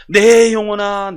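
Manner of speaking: loud, drawn-out stern call; stern, ringing summons; scolding one's own soul. Acted. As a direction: call out to your soul as a rebuke. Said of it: "내 영혼에게 호통을 치는 거예요".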